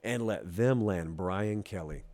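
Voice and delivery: low, slow, softened question; slow, quiet dismay at a rival; grave reproach. Acted. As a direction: scold in a hushed, drawn-out low voice. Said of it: "we were talking to the rest of the S E C".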